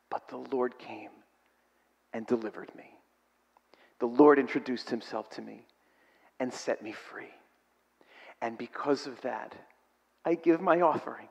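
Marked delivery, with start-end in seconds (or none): 0.1-9.6 much softer, heartfelt testimony. Real, not acted. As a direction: hush it like a confession. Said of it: "personal testimony, much softer".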